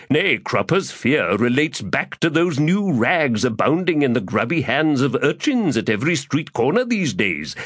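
florid, theatrical gentleman rogue; lofty, self-pleased orator; pompous Crupper voice. Acted. Crupper speaks.

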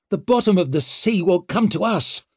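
high, exasperated growl; growled complaint. A narrator's reading. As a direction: finish the grumble loudly over the rain. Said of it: "growled Savine".

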